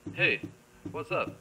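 ordinary delivery

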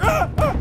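two high, loud yelps of fright; startled yelps. Real, not acted. Player startled mid-sentence by the game, startled yelps. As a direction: yelp in sudden alarm.

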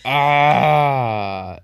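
sudden loud cry of frustration; frustrated yell. Real, not acted. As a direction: let out a sharp angry shout. Getting killed in game.

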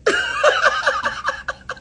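squealing burst of laughter; real giggle fit; high laugh. Real, not acted. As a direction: crack up hard. Laughing at friend's joke.

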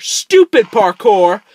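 high, loud, slow yell of STUPID; furious shout. Real, not acted. Player cursing the parkour, loud high shout. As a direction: explode at the game.

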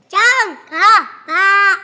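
slow, loud, stretched repeat; loud and drawn out. Real, not acted. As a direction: belt it out slowly for emphasis. Kid repeats his answer louder.

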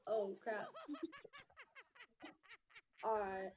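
shocked laughter. Real, not acted. Laughing at the video.

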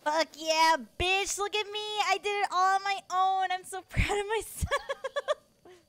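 high, loud bragging with a laugh in it; triumphant gloating. Real, not acted. Streamer bragging to chat after a solo win, loud high gloat. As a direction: crow about doing it all alone.

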